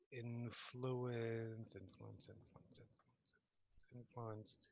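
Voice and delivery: very low, barely audible muttering; muttering to oneself. Real, not acted. Player searching the sheet for influence, muttering to herself. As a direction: repeat the word under your breath, fading.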